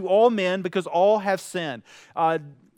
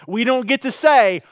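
usual speaking voice

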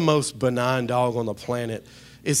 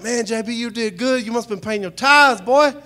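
high, louder hyped-up praise; admiring praise; 9 semitones higher, 7 dB louder than usual. Retold or imitated. Friends praising the pastor's selfie, high and hyped. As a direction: hype him up like a proud friend. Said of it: "Everybody's like".

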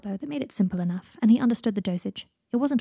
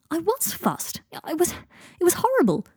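distressed; 10 semitones higher, 4 dB louder than usual. Acted. Harry distressed defending.

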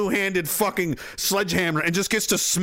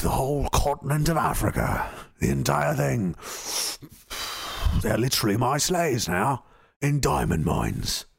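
sophisticated villain; 6 semitones lower than usual. Pretend. Cigar-smoking villain sneering at the Night Stalker, low posh purr. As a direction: savour it like a cigar-smoking supervillain. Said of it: "they'd be smoking their cigars".

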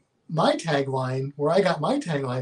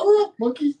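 high squeaky call; 12 semitones higher, 6 dB louder than usual. Pretend. Continues the silly squirrel call.

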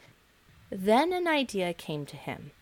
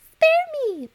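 tiny, very high squeaky plea, louder; begging mouse; 8 dB louder than usual. Acted. The trapped mouse begs the lion, squeaky and high. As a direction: squeak out a desperate plea for mercy. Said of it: "begged the poor mouse".

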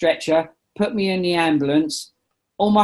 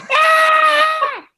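high, loud, startled scream; imitating an old woman's sudden scream; old lady's scream; 5 dB louder than usual. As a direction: shriek like a shocked old lady. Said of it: "and then she screamed".

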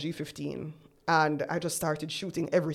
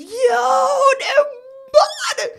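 much higher, loud crying shout; screaming; 11 dB louder, much slower than usual. Retold or imitated. Friend phoning back about the photos, ecstatic scream. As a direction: scream it out in pure delight. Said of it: "all I could hear was screaming".

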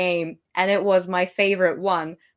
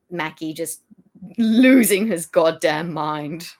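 ordinary talk, nothing marked

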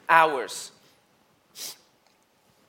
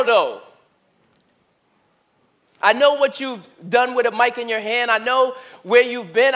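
the Lord's challenge, higher and louder, pressing hard; God's voice, preached; 5 semitones higher, 6 dB louder than usual. Retold or imitated. The Lord questioning him at his dad's bedside, insistent and loud. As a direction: voice God confronting him, urgent and loud. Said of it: "where the Lord is saying to me".

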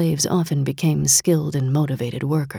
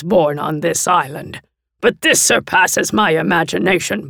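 zealous warlord envoy; 4 semitones higher, 6 dB louder than usual. Acted. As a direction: preach the master's glory. Delegate zealot voice.

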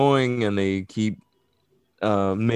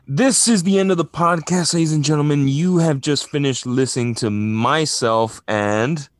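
raised, projected announcer voice; podcast sign-off announcer; 6 semitones higher, 4 dB louder than usual. Acted. Host signing off to the podcast audience, big announcer voice. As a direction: announce the end of the show grandly.

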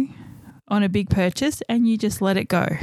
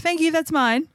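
pitched-up, cheeky thank you; cheeky higher voice; 7 semitones higher than usual. Retold or imitated. Teller at the café mocking herself, cheeky and higher. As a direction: sweetly claim the coffee as your own. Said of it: "I'm like".

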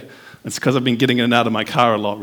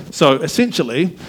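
ordinary talk, nothing marked

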